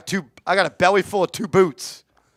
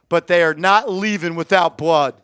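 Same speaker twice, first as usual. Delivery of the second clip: usual speaking voice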